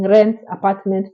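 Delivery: ordinary talk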